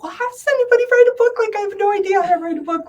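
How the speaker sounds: a frantic, overwhelmed squeal; flustered panic. Retold or imitated. Retelling her panic about writing a book, high and flustered. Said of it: "I'm like".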